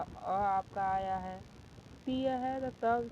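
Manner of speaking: अ and त drawn out slowly as separate sounds; slow sounding out. Shown as a demonstration. Teacher to students, slowly sounding out the sounds. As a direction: break the word into its sounds, slowly. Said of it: "र का भी उच्चारण करेंगे तो अ".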